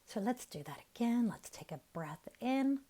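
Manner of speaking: soft, unhurried meditation voice with long pauses; guided meditation. Real, not acted. Teacher guiding listeners to relax their face and jaw, soft meditation voice. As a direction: ease them gently into relaxation, leaving room to breathe.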